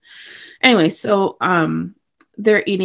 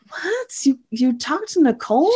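a sudden very high startled what; shocked what; 5 semitones higher than usual. Retold or imitated. Waverly hears her secret was shared, a high startled cry. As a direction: leap up in pitch, caught off guard. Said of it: "and Waverly's like".

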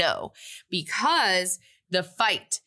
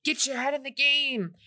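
higher coach-like urging; rally her like a coach; lightly voiced teachers cheering her on; pep talk; 7 semitones higher than usual. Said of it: "they're like".

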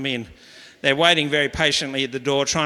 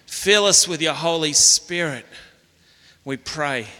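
prayer. Real, not acted. Closing prayer.

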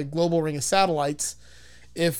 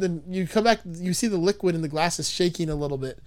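usual speaking voice

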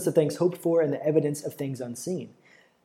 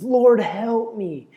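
high, loud, very slow pleading wail; desperate cry; 7 semitones higher, 6 dB louder, much slower than usual. Acted. Mother kneeling before Jesus, crying out, high and drawn out. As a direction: beg as if her daughter's life depends on it. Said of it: "She's crying out in desperation".

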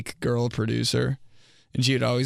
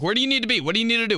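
exasperated questions; 11 semitones higher, much faster than usual. Retold or imitated. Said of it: "I'm like".

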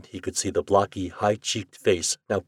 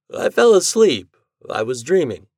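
sleepy; 7 semitones higher, 7 dB louder than usual. Acted. Lee just woken from sleep.

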